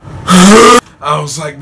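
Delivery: loud slow laugh through the words; real laughter. Real, not acted. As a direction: burst out laughing. Laughing while retelling.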